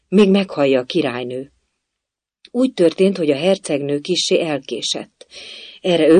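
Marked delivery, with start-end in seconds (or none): none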